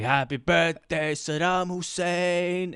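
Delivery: drawn-out, strained mock holler; mock scream. Comic imitating the worst comedian on stage, drawn-out mock scream. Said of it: "he was screaming".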